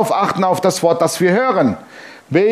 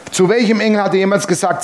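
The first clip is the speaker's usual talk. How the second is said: ordinary talk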